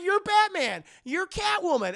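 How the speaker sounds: high, loud revelation; hammed-up gasping realisation; mock astonishment. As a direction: blurt each discovery high and loud. Characters voiced, discovering identities.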